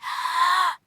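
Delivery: long, steadying breath; deep breath. Acted. Worked-up caller settling their nerves, deep breath. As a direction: breathe deeply to calm yourself. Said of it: "let me just take a deep breath".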